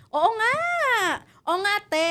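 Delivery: high, loud sidekick agreement; hammed-up sitcom reaction line; comic feed role. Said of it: "ang role ko lang".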